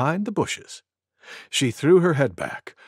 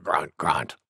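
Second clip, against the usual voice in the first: very low, soft boar grunts; grunting boar; 11 semitones lower, 5 dB quieter than usual. A narrator's reading. Wild Boar after her big sneeze, low grunting. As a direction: drop deep and grunt the words.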